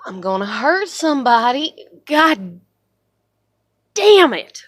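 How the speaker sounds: exasperated outburst, rising to a loud curse; real frustrated fury breaking out; really mad. Real, not acted. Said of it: "I'm so mad".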